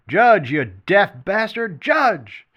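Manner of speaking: yelled loud and high across the bar; shouting. A narrator's reading. Larry yelling across the bar at the judge, shouting. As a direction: bellow at the deaf old judge. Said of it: "Larry shouted".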